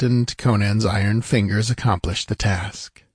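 ordinary delivery

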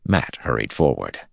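ordinary delivery

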